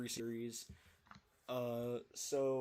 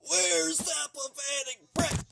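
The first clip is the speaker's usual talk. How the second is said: much higher and louder sneering villain taunt, drawn out; Sage's villain taunt; 12 semitones higher, 8 dB louder, slower than usual. Acted. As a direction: sneer it like a cartoon villain hunting someone. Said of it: "a reference to Cow's journey log".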